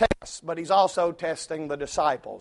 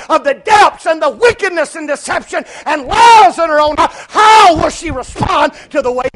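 raised, forceful sermon voice; full-force preaching; 9 semitones higher, 12 dB louder than usual. Acted. Preacher asking how she will respond, full-force preaching. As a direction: drive the question home loudly.

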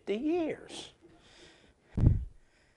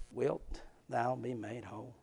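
hushed voice; 7 semitones lower, 16 dB quieter than usual. Acted. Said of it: "he ain't heard a voice like this".

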